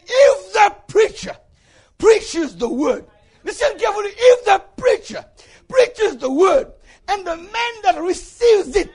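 loud, high sermon building to a shouted climax; full-force preaching. Real, not acted. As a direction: hammer each phrase home to the congregation.

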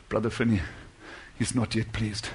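low, soft, grave report; burdened hush. Retold or imitated. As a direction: confide it softly, as if weighed down. Burdened man confides in preacher.

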